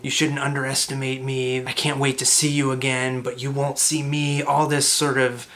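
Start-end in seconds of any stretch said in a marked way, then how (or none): none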